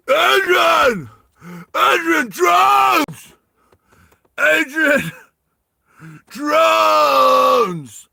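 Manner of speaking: high, full-throated hammed-up scream; Sylvester Stallone. Climber warning Adrian about drones, over-the-top screamed impression. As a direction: bellow it with long drawn-out vowels. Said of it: "Sylvester Stallone's character's".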